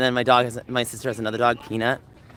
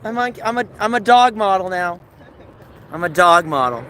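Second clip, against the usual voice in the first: excited; 8 semitones higher, 6 dB louder than usual. Real, not acted. Excited boast to owner.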